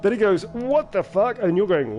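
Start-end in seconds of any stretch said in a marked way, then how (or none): 0.5-1.4 higher-pitched, comic startled exclamation; mock shock. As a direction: blurt it as the baffled character in your story. Voicing startled shark victim in story.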